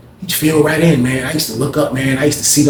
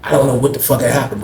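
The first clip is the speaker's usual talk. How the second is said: ordinary delivery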